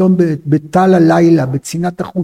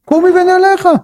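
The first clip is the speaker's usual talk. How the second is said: very high, insistent order; curt command; 10 semitones higher than usual. Acted. Husband ordering unresponsive concubine up, commanding. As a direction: pitch it high and order her up. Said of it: "פקודה".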